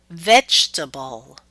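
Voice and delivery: "vegetable" with a strong first syllable, VEG; exception stress pattern. Shown as a demonstration. Teacher gives an exception, "vegetable" stressed on VEG. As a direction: punch VEG in "vegetable", drop the rest. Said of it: "there will always be exceptions".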